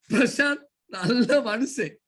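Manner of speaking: "Prashanth" and "நல்ல" drawn out slowly, teasing; mocking delivery. Shown as a demonstration. Teacher showing Prashant a second way of saying it, drawn-out teasing. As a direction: stretch "நல்ல" in slow mock praise. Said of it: "மூணு விதமா சொல்றேன்".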